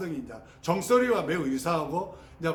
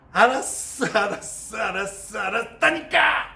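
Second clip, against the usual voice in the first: irritated retort; 3 semitones higher than usual. Retold or imitated. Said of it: "짜증내면서".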